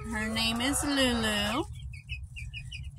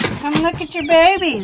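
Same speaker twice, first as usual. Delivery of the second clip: louder, higher coaxing call; calling the dog; 5 semitones higher, 9 dB louder than usual. Real, not acted. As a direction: coax the dog over loudly.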